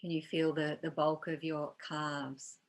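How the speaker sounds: calm, unhurried meditation guide with long pauses; guided meditation. Real, not acted. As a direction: gently lead them into body awareness, leaving space between phrases.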